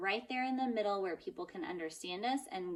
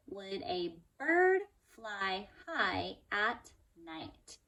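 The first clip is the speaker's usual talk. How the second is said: slow word-by-word reading; much slower than usual. Shown as a demonstration. Teacher modelling a slow first read for young pupils. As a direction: read it like a beginner tracking each word. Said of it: "Now you're gonna notice".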